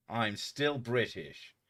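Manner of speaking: ordinary delivery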